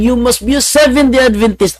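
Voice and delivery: high pastor voice proclaiming; loud preaching. Retold or imitated. Pastor preaching loudly across the street, high voice. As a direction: call it out like a preacher. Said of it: "Ang lakas ng boses niya".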